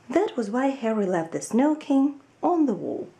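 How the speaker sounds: ordinary delivery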